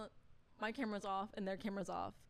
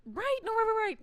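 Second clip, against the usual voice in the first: eager agreeing; 12 semitones higher, 5 dB louder than usual. Retold or imitated. Her eager reply to director.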